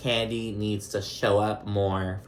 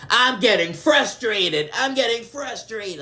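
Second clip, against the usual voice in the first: high-pitched, drawn-out imitation of exasperation; hammed-up frustrated wail, stretching the words; mock frustrated; 12 semitones higher, 3 dB louder, slower than usual. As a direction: mimic someone whining in frustration, way up high. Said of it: "she gets frustrated".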